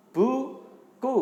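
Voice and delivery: high, loud model word for pupils to repeat; sounding out words. Shown as a demonstration. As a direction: call the word out slowly for the class. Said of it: "Yuk ikuti [name]". (mengeja kata dengan lantang)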